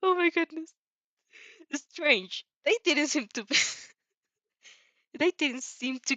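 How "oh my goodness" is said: usual speaking voice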